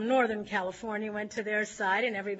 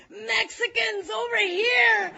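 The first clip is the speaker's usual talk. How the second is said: slow, much higher laughing shout; rallying shout; 10 semitones higher, 6 dB louder, slower than usual. Retold or imitated. Danny T on a table rallying his following, a loud laughing shout. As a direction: bellow it from up on a table.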